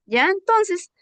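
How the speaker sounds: ordinary talk, nothing marked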